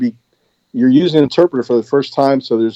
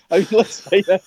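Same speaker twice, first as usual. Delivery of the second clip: ordinary delivery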